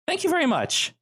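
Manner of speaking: put-on very high-pitched thank-you from the girl; high voice, falling at the end; girl character. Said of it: "she looks at you and goes".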